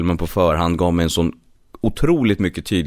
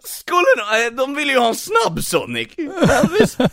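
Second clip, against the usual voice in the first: very high, dopey run-down Sonic; high washed-up Sonic voice; Ugly Sonic voice. Shown as a demonstration. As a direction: Sonic but more down and out. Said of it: "Hur låter Ugly Sonic?".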